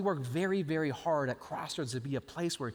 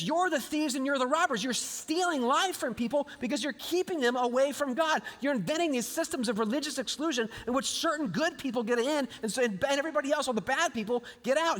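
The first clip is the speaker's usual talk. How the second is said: higher, heated accusation; Jesus rebuking the Pharisees, heated and higher; indignant rebuke; 8 semitones higher than usual. Retold or imitated. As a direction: pile on the charges against the Pharisees. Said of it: "He's mad at them".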